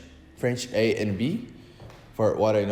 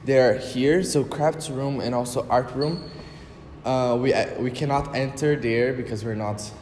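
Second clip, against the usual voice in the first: usual speaking voice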